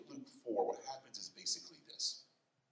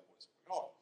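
higher-pitched astonished question; retold bewilderment, voice raised in pitch; 8 semitones higher, faster than usual. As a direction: ask it as a baffled onlooker, pitch lifted. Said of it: "It says that they were astonished".

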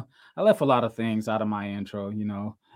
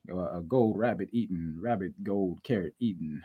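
normal talking voice

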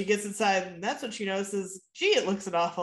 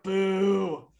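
mock boo; 4 semitones higher, 6 dB louder than usual. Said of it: "And I wrote".

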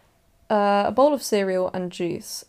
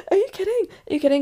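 high, excited squeal of disbelief; can't believe the luck, thrilled; delighted gasping question; 11 semitones higher, much faster than usual. Real, not acted. Incredulous at rain during song.

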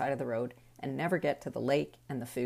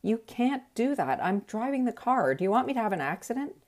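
raised stern dad voice, pitched up; partner scolding kids; 8 semitones higher, 4 dB louder than usual. Retold or imitated. Partner scolding the kids from the driver's seat, raised stern voice. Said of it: "my partner would say".

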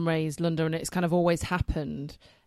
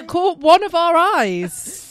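playful correction; 10 semitones higher, 12 dB louder, much slower than usual. Acted. Jokey correction of wording.

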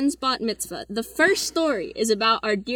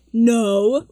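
loud retold objection; emphatic, louder no; really loud; 7 dB louder than usual. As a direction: blurt it out loudly, imitating yourself. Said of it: "really loudly".

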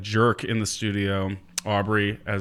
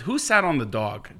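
ordinary talk

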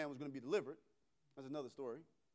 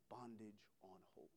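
dramatic whisper; 9 semitones lower, 19 dB quieter than usual. Acted. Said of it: "dramatic whispered punchline".

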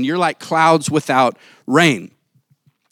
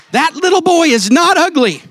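high, loud mock outrage; indignant stranger; 11 semitones higher, 5 dB louder than usual. Stranger defending the boy, high loud mock outrage. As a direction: put on a scandalized bystander's voice. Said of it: "they were looking at me like".

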